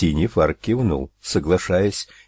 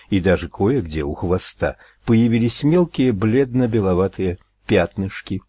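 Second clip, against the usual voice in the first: ordinary delivery, nothing marked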